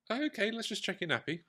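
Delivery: high, sing-song parent voice; parent to toddler. Retold or imitated. Parent checking a child's nappy, high sing-song voice. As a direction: pitch it right up for a small child.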